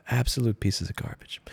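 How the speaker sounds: whispered in disgust. Real, not acted. Vlogger condemning the company, whispered with disgust. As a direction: hiss the verdict under your breath.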